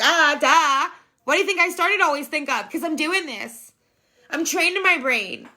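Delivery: high-pitched "duh" comeback; indignant retort. Retold or imitated. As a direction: argue back in a high, exasperated voice. Retorting to criticism of her thinking.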